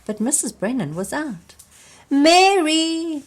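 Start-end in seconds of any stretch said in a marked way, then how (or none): 2.1-3.2 high, loud yell; a little boy yelling for his sister, high and loud. A narrator's reading. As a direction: yell out her name. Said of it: "yelled John Joe".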